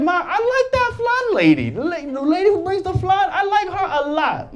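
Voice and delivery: a hint of a small boy telling his mom; sweet kid gushing. Seven-year-old telling his mom.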